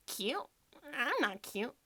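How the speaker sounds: odd character voice with questioning lifts; Froppy. Acted. Reading a quiz answer in character, Froppy impression. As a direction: put on a character voice, ending phrases on a rise. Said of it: "I can do my froppy accent".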